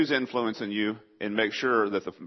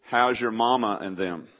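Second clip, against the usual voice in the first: slow East Texas drawl; East Texas accent. Shown as a demonstration. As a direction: demonstrate a folksy East Texas greeting, drawn out. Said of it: "here's how you say "How are you doing?" in East Texas".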